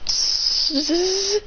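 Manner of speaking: high sustained hiss-buzz; voiced power-up buzz; imitated buzzing noise. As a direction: make a long "zzz" sound. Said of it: "puts on his fox mask and goes".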